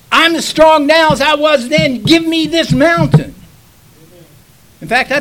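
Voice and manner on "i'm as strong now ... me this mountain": loud, raised-pitch preacher's quote; bold declaration to God. Acted. Preacher as Joshua claiming his mountain from the Lord, loud and bold. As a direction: proclaim it forcefully, high and loud. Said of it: "when he said to the Lord".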